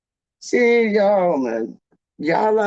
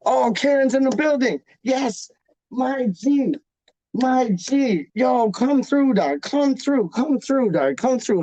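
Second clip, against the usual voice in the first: higher, hyped welcome; excited greeting; 6 semitones higher than usual. Real, not acted. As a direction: hype up a friend arriving. Greets viewer Karen joining chat.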